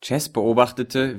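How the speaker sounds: usual speaking voice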